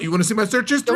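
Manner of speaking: high-pitched comic eagerness; mock eager confession. Playing a bro admitting curiosity, mock eager. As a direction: blurt it like a guilty oversharer.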